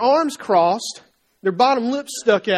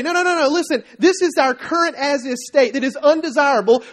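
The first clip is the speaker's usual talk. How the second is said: raised-pitch, insistent correction; parent's insistent lecture; 4 semitones higher, 4 dB louder than usual. Acted. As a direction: cut in and lay it out for the kids. Parent lecturing reluctant kids.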